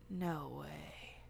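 whispered, barely audible retold line; hushed realisation. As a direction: whisper it low, as it dawns on him. Voicing character's dread realisation.

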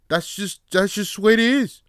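loud, high hammed-up Boston guy; loud mock Boston accent drifting into New York; bad Boston accent. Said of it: "I don't know how to do a proper Boston accent".

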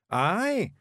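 high Scots "aye" of pride; proud Scottish Feegle. A narrator's reading. Rob confirming he has a written plan, proud. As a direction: say it proudly, pitched well up. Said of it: "said Rob proudly".

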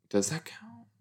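breathy high whisper. Real, not acted. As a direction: ask it under your breath. Whispered aside while watching.

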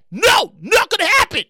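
loud flat no; shouted refusal. Real, not acted. Shouting no at the idea.

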